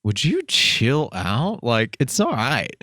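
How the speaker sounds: slow, easygoing reassurance; drawn-out, relaxed chill-out; calming down a friend. Retold or imitated. Host retelling calming his friend, slow and easy. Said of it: "And I'm like".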